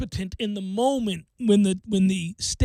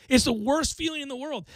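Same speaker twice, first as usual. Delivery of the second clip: usual speaking voice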